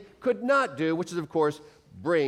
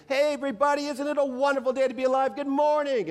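much higher, bouncy morning-person voice; cheery Fred greeting; 10 semitones higher than usual. Retold or imitated. Meat manager greets exhausted stockers at five, overjoyed. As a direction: sing out the greeting like the happiest man alive. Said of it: "Fred was always so happy".

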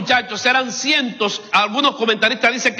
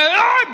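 high-pitched mock yelp; reenacted shriek at the cold shower; cold-water yelp; 11 semitones higher than usual. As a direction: yelp as if icy water hits. Acting out shock of cold water.

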